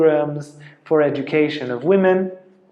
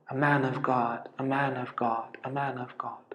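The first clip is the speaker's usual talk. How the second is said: fading reverent repetition; 9 dB quieter than usual. Retold or imitated. Old man falling at his feet.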